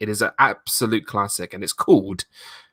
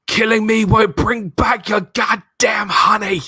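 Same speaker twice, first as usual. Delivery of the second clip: Nicolas Cage impression; 9 semitones higher, 4 dB louder than usual. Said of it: "the first one is".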